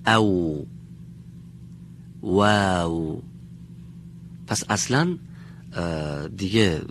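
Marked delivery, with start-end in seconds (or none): 0.1-0.7 rounded lips, no teeth, "او"; Arabic waw sound. Shown as a demonstration. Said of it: "توجه بفرمید". 2.2-3.2 "واو" drawn out slowly with rounded lips; careful slow letter demo; slow waw demo. Shown as a demonstration. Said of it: "توجه بفرمید".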